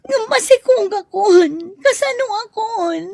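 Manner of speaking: high, loud pleading sob; desperate tearful cry. Acted. She tells lover she is pregnant.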